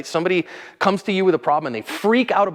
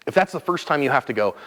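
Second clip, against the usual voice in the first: ordinary delivery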